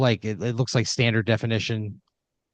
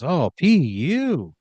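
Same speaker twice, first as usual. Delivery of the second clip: host mocking a stench; 6 semitones higher, 4 dB louder, much slower than usual. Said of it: "he, like, waves his nose".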